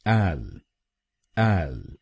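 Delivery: एल said as AL on its own, the stressed syllable; syllable demo. Shown as a demonstration. Teacher models the second syllable, AL. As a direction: isolate एल as AL. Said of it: "हम बोलेंगे".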